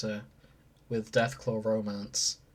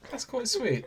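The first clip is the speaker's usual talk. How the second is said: laughing aside; 10 semitones higher, 4 dB quieter than usual. Real, not acted. Reacting to fan art, laughing.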